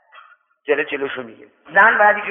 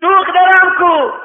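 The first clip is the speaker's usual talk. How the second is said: high, loud, drawn-out shout; acted father bellowing his question; impatient demand; 11 semitones higher, 7 dB louder, much slower than usual. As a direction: cry out slowly for the daughter. Father demands daughter, losing patience.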